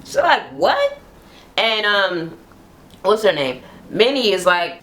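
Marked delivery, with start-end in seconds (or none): 0.5-1.0 very high-pitched indignant question; mock outrage, voice shooting up; mad, incredulous. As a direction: imitate an outraged "what?". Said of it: "is mad as hell".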